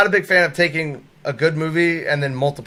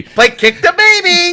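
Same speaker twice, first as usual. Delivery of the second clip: cartoon kid voice; 13 semitones higher, 4 dB louder than usual. Quoting South Park game.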